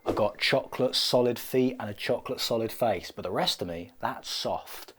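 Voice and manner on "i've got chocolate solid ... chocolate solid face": usual speaking voice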